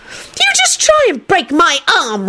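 high, slow, daring squeal; angry squeal. A narrator's reading. Rosie daring Kenny to hurt her, squealing angrily. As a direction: dare him in an angry squeal. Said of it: "Rosie squealed angrily".

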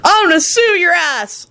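high, dopey stoner voice; a stoned guy marvelling at junk; stoner dude. Acted.

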